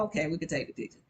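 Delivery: softly agreeing to the guard; soft giving in. Retold or imitated. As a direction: give in quietly. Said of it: "I was like".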